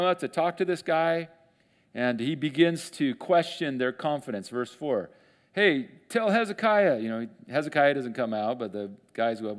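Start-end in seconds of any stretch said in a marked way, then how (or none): 5.5-7.0 calling out. Retold or imitated. Envoy calling to leaders.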